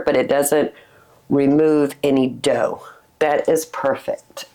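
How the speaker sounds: normal talking voice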